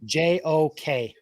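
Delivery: slow letter-by-letter spelling. Shown as a demonstration. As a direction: spell it slowly, one letter at a time. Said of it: "let me know the spelling of that".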